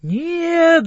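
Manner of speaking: high, sharp little shout. A narrator's reading. Left ants shout back at the right ones, high little cry. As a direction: cry out a tiny alarmed no. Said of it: "закричали левые мурашки".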